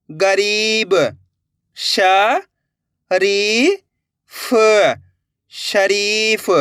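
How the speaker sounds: slow, drawn-out sounding out with pauses; syllable-by-syllable reading. Shown as a demonstration. Teacher showing learners how to join letters, sounded out slowly. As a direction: stretch each letter, then join the word slowly. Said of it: "एक एक अक्षर का उच्चारण करके".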